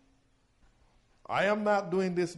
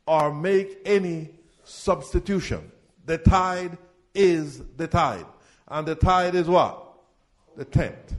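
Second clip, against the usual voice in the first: loud, driving call-and-response preaching; full-force preaching; 3 semitones higher, 8 dB louder than usual. Acted. Pastor calling the tithe verses to his congregation, loud preaching.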